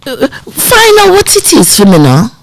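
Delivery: high-pitched Jamaican mother's request; mum's Jamaican accent. Retold or imitated. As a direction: imitate a Jamaican mum giving her child a task. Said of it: "she had a strong Jamaican accent".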